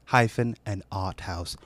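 put-on British accent. Knighted announcer reading a magazine blurb, British accent. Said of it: "listen to a British accent".

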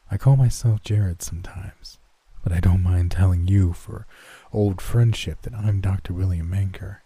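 plain reading voice

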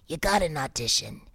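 mock talent-manager voice; imitating the manager. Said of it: "he talked like this".

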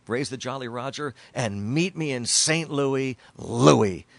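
loud, slow, drawn-out broadcast call of "meet me in Saint Louis Louis"; announcer reliving the clinching out, triumphant and stretched; recreated home run call. Retold or imitated. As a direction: belt it like the game-winning radio call. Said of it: "that's probably my favorite call".

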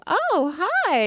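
high, bright "oh hi" to a ghost beside her; cheery greeting to a spirit. Retold or imitated. Psychic greeting a spirit at the sink, casual and high. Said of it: "and I'll go".

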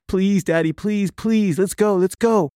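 high-pitched imitation of a begging son; pleading child. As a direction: plead like an upset kid, voice pitched well up. Frantic son begs to leave.

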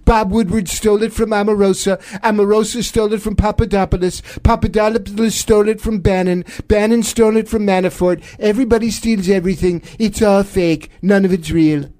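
blustering presidential impression; Donald Trump. President denying there is a leaker, blustering impression. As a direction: mimic a defensive, emphatic president. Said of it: "enough of Don".

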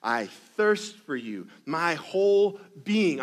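slow, earnest psalm reading, each word weighted; a touch of longing; slow reverent reading. Acted. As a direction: read the psalm slowly, longing in every word. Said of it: "he says".

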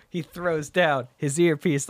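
ordinary talk, nothing marked

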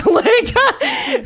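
high laughing shout; loud laugh. Real, not acted. Teased by a friend, loud laugh. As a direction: burst out laughing. Laughing at "you suck" joke.

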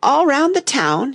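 high, slow, nervous question; nervous quiver. A narrator's reading. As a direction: ask nervously in a lifted, drawn-out voice. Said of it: "very nervous, and his voice quivered".

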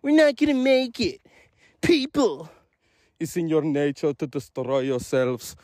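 put-on lightly done Terminator, Austrian accent; a hint of Austrian robot Terminator doing his line; acting out the Terminator for the older kids in a mild Austrian accent; Arnold Schwarzenegger voice. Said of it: "So I acted it out for them".

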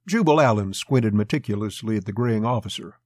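ordinary delivery

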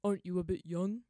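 loud, rough seedy merchant voice; a suspicious merchant looming over her; gruff merchant. A narrator's reading. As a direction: merchant questioning a lone girl, loud. Merchant voice continues.

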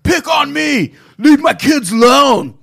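high, loud desperate yell; furious dad shouting at the ghost. Retold or imitated. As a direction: yell it to protect your kids. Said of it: "shouting at the top of his lungs".

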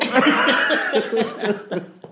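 high, loud burst of laughter; big cackling laugh. Real, not acted. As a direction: crack up out loud. Laughing at the quote.

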